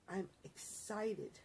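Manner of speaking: normal talking voice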